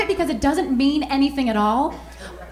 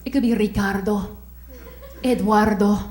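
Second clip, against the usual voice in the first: 1 sultry; 5 semitones lower, 4 dB quieter than usual. Pretend.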